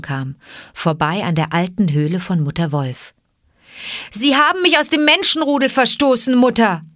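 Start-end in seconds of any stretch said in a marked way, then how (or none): 4.1-6.8 high, raised cry to mother; calling out. Acted. Mowgli arriving at Mother Wolf's cave, calling out. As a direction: call up to the cave urgently. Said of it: "rief Mowgli".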